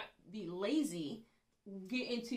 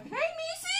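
high, loud, laughing call; gushing greeting; 7 dB louder than usual. Acted. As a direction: overdo a hello to a coworker. Calls out a greeting to coworker.

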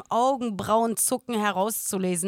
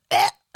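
loud mock gagging; exaggerated grunt of disgust; imitated retching noise; 8 dB louder than usual. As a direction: act out gagging at the food. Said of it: "macht wirklich".

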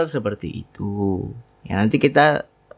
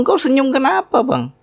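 higher, lightly voiced wife asking; a hint of a confused wife; puzzled wife. Wife asking husband why he smiles, higher puzzled voice.

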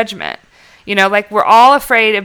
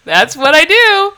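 higher, slower, laughing confession; laughing admission; 8 semitones higher, 4 dB louder, slower than usual. Retold or imitated. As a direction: own up through laughter. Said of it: "then it's like".

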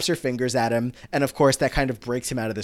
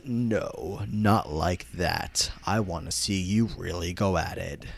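low, slow, softer menace; evil distorted villain; 5 semitones lower, 5 dB quieter, slower than usual. Acted. Sue rejecting the boys' quick kiss, evil villain voice. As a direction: draw it out like a hammed-up villain. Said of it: "in this very evil, distorted voice".

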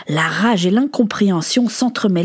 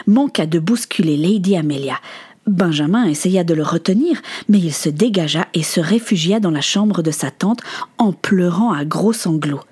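usual speaking voice

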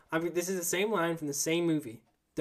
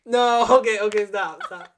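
loud, higher outburst; alarmed protest; 5 semitones higher, 11 dB louder than usual. Real, not acted. Host recoiling as guest cracks fingers, loud protest. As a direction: cry out to make it stop.

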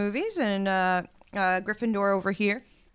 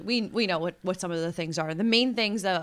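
ordinary talk

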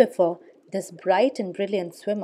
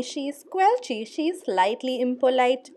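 ordinary delivery

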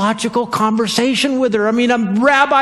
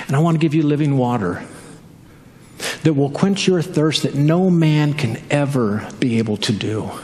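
lower, earnest Jesus voice; voicing Jesus; 9 semitones lower, 3 dB quieter than usual. Preacher voicing Jesus low to the Samaritan woman. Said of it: "And then Jesus declared".

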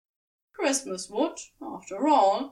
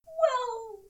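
much higher, fussy angel voice; sheepish admission, voice pitched way up; Aziraphale; 13 semitones higher than usual. A narrator's reading. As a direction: Aziraphale admits it to Crowley, sheepish and high. Aziraphale flustered.

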